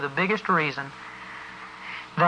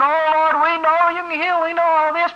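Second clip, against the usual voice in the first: higher, wailing imitation of a moaning prayer; mock moaning prayer; 8 semitones higher than usual. Preacher mimicking a woman's moaning prayer, wailing. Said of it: "this lady was there moaning and groaning".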